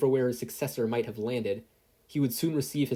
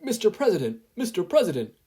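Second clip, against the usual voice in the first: much higher, louder urgent call; 10 semitones higher, 7 dB louder than usual. Acted. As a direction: burst in calling for attention. Secretary runs in alarmed.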